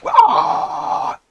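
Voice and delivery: high unvoiced yell of joy; headbanging yell. Fan screaming along to the metal track, high unvoiced yell. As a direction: let out a wild metal scream. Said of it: "you just want to go".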